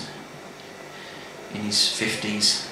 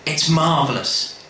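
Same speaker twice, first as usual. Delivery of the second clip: plain reading voice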